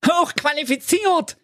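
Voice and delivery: very high, hooting mockery of the word; mocking snort. Friends mocking the donkey's boast, high hooting squeal. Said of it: "prusteten immer wieder".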